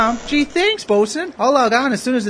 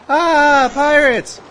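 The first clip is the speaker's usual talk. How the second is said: frightened cry; 5 semitones higher, 6 dB louder, much slower than usual. Retold or imitated. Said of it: "the enemy crew look at all of you and go".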